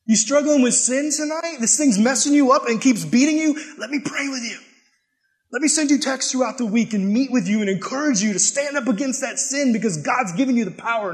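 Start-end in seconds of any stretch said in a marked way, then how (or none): 0.1-5.9 high, urgent sermon voice; fervent preaching. Acted. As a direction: call out to the crowd. Preaching at full force.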